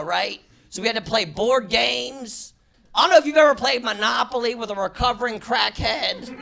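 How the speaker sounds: normal talking voice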